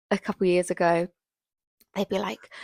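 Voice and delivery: normal talking voice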